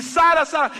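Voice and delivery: high, loud cry of a name; shouting. Acted. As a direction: yell for your lost child. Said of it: "a gritar". (gritando angustiado)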